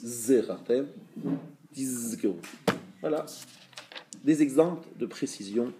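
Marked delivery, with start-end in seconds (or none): 0.0-2.4 "Zechartem", pause, "tizkeru", each Z held and buzzed; precise zayin. Shown as a demonstration. Teacher urging discreet but precise reading, zayin drawn out. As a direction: linger on the Z at usual loudness, unhurried. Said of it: "essayez quand même d'être précis".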